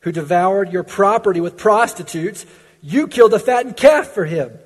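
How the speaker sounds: loud, high indignant outburst; angry older brother shouting at his father; indignant son. Acted. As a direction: spit it out in resentment. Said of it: "You sense his indignance".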